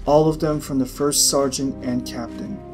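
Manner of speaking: usual speaking voice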